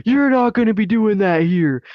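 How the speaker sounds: high-pitched mock scolding; retold yelling. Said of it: "he just fucking yells at me".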